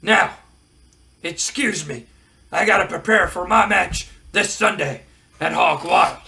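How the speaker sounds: loud, brash wrestler bragging; a touch of Hulk Hogan swagger; Hogan promo voice. Retold or imitated. Wrestler boasting into the camera, brash promo voice.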